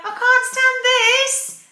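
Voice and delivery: loud, high, slow storybook complaint; exasperated Mister Bear. Acted. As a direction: wail it slowly, utterly fed up. Fed-up bear exclaims in story.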